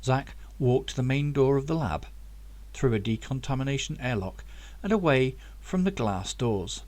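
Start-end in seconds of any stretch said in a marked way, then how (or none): none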